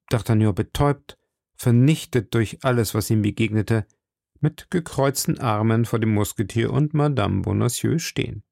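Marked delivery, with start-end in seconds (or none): none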